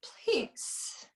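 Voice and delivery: breathless whispered please; barely able to voice it; terrified whisper. Acted. Victim whispering a plea to the killer.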